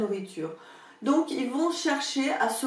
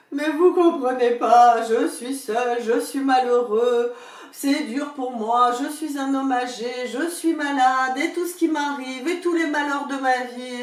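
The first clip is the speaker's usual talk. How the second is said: mock lament; 3 semitones higher, 7 dB louder than usual. Old man begging sympathy from the pharmacist, loud whiny lament. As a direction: play the poor pitiful old man. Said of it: "le mur de lamentation".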